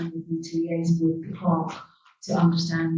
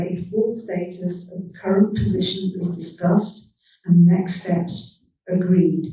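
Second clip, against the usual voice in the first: slowed down on request. Real, not acted. Chair asked to slow down, reads the proposal slower. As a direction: read slower, each clause spaced out. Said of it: "Shall I slow down?".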